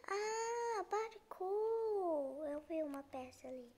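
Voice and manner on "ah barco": high, loud cry of discovery; excited cry. Real, not acted. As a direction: shout it out, thrilled. Spots the boat in game.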